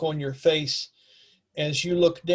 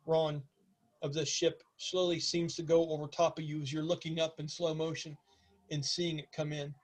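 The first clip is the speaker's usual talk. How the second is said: soft, measured game master voice; hushed closing narration; 6 dB quieter than usual. A narrator's reading. Game master ends on the descending ship, hushed narration. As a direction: let the scene fade out quietly.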